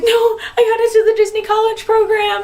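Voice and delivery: blurting out the big news; thrilled squeal. Retold or imitated. Explaining her news, thrilled.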